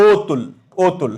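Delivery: অতুল said as the model form; teacher repeats অতুল; demo pronunciation. Shown as a demonstration. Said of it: "এটার উচ্চারণ হয় এরকম".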